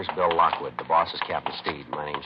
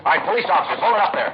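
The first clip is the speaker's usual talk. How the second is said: loud, high command to stop; shouted order; 13 semitones higher, 8 dB louder than usual. Acted. Detective shouting at a fleeing suspect, loud and high. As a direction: yell it after a running man.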